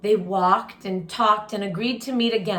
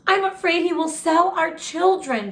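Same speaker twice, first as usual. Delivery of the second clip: higher, worried plea from the wife; a touch of fear in a raised voice; wife voicing her worry, a little higher and louder; 8 semitones higher, 3 dB louder than usual. A narrator's reading. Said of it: "But Nancy was worried".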